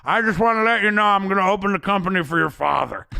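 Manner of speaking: high, loud hammed-up boss proclaiming at a funeral; lightly voiced boss promising big with a laugh; mock grand boss speech. Boss addressing the mourning son at the funeral, mock proud shout. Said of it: "walks around at his funeral and goes".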